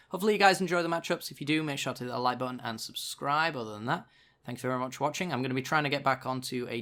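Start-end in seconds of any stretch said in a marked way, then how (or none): none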